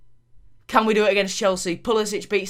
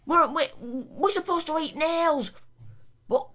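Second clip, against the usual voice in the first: higher, pleading terrace moan at the players; exasperated Burnley fan; 8 semitones higher than usual. Acted. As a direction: yell at the lads like a fed-up Burnley fan. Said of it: "I'm sort of losing the accent".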